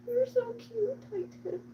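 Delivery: high, cutesy doting voice; adoring pet coo. Real, not acted. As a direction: gush sweetly at a beloved cat. Cooing to her cat Titan.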